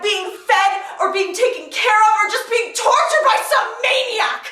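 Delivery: building anguish. Acted. Wife describing a mother's agony, building outrage. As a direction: let the pitch climb steadily to an anguished peak.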